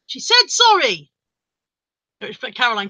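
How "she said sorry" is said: high-pitched indignant defence; raised, emphatic protest, slowing at the end; piping up. Retold or imitated. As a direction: re-enact butting in to defend someone, insistently. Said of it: "this is the point I piped up".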